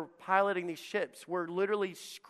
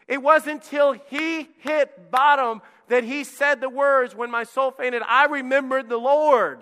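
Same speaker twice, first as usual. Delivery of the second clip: louder, higher preaching voice; full-force preaching; 8 semitones higher, 7 dB louder than usual. Acted. Pastor to congregation warning of storms, fervent preaching.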